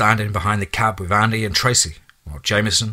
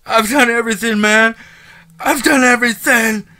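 much higher, louder desperate begging scream; screaming, crying plea; 13 semitones higher, 6 dB louder than usual. Acted. Prisoner pleading with the departing man, hysterical crying scream. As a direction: beg for your life, sobbing and screaming. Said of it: "screaming and begging and pleading and crying".

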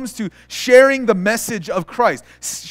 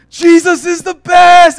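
loud, high, drawn-out zealot's shout, imitated; hammed-up passionate cheer; super excited; 10 semitones higher, 9 dB louder, slower than usual. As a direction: yell it like an overzealous street evangelist. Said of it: "super passionate, super excited".

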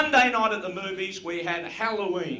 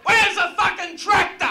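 much higher, loud shouted farmer voice; farmer yelling; 13 semitones higher, 6 dB louder than usual. Pretend. Comedian's farmyard impression done as a shouting farmer. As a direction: yell out like a fed-up farmer who lost his tractor. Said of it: "impersonation of farmyard noises".

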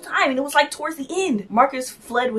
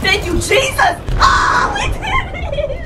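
1 joyous relieved shout; 14 semitones higher, slower than usual. Real, not acted. Relief after surviving.

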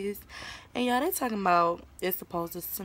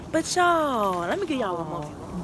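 excited; 4 semitones higher, 4 dB louder than usual. Real, not acted. Excited about the snow.

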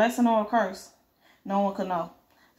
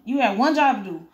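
plain reading voice

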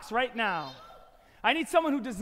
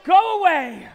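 mock-angry shout; 2 semitones higher, 9 dB louder than usual. Banishing the shoelace.

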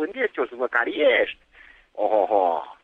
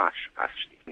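whispered low threat; menacing whisper; 12 dB quieter than usual. Real, not acted. Neighbour ending the threat in a whisper. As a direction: hiss the last word at him.

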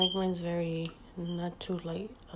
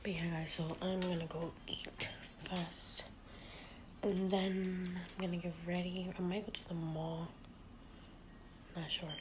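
whispering; 5 dB quieter than usual. Real, not acted. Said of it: "Sorry I'm speaking low".

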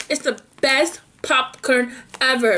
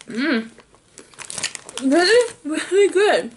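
talking while chewing food; muffled by snack in mouth; mouth full, chewing; 2 semitones higher, slower than usual. Real, not acted. Seen 0: Hand to mouth at -1; lips pursed, chewing during words.

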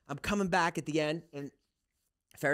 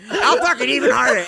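loud, high imitation of a rival's boast; hammed-up competitive shout; mock one-upping; 10 semitones higher, 12 dB louder than usual. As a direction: top the last boast at full volume. [name] mimicked topping the boast.

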